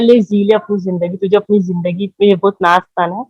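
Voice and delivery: normal talking voice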